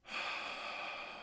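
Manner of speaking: long, soft breathy sigh; meditative exhale. Shown as a demonstration. Guide modelling the breath for listeners, soft exhale. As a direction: breathe out slowly and audibly.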